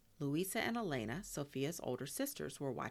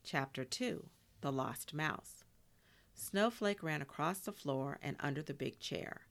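usual speaking voice

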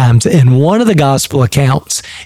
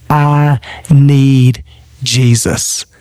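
humble confession; much slower than usual. Acted. A humbled believer admitting need, slow and tender. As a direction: let it sink in, drawn out.

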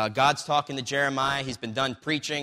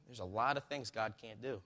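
much softer, understated restatement; quiet repeat; 2 semitones lower, 11 dB quieter than usual. Retold or imitated. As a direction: repeat it gently under your voice. Retelling his softly repeated answer.

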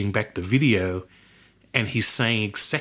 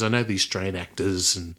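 normal talking voice

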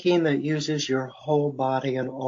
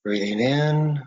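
guided meditation; 3 semitones lower than usual. Real, not acted. Faculty member leading listeners through breathing and imagery, guided meditation. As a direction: guide them into relaxation, phrase by unhurried phrase.

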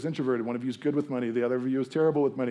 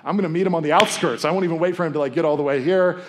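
eager Martha; 5 semitones higher, 8 dB louder, faster than usual. Retold or imitated. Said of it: "is like".

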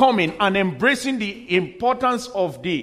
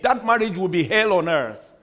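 ordinary talk, nothing marked

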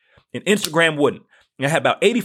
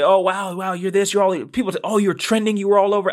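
higher-pitched mock amazement; mimicked admirers; 6 semitones higher than usual. People telling him he is trending, mimicked in a higher excited voice. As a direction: imitate gushing, impressed fans.

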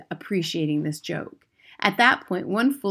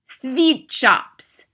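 shouting; 7 semitones higher, 7 dB louder than usual. Acted. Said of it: "shouted the Grand High Witch".

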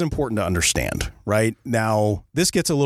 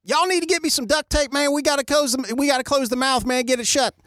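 put-on hillbilly accent. Skeeter on the alligator asking for duct tape, hillbilly accent. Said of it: "I'm just gonna use an accent".

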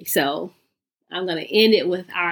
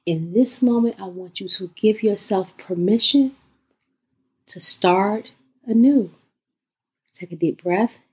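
guided meditation. Acted.

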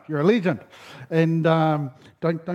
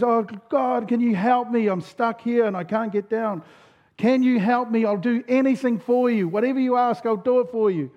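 higher-pitched acted plea; desperate pleading; 5 semitones higher than usual. Stranded hiker begs God for help, acted pleading. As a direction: beg for rescue, mildly hammed-up. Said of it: "Jack in his desperation".

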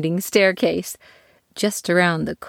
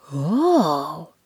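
surprised. Acted.

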